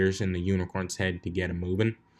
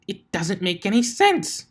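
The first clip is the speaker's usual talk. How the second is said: higher and louder, exasperated; frustrated outburst; 10 semitones higher, 3 dB louder than usual. Acted. Twilight yelling at the stubborn apple tree, exasperated. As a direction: blurt it out in frustration.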